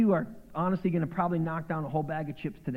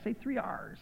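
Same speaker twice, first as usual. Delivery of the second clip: pirate voice; 7 semitones higher, 6 dB quieter than usual. Shown as a demonstration. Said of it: "you sound like a pirate".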